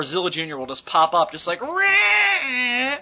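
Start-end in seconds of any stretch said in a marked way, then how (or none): 1.6-3.0 high, drawn-out mock screech; imitated monster roar. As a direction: screech it like a kaiju popping up. Imitates Zilla Junior popping up.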